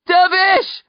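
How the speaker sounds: loud, high urgent yell; calling out. A narrator's reading. Nephew hurrying over to a sleepwalker, calling out. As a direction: yell the name to reach him. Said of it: "I call, hurrying over to him".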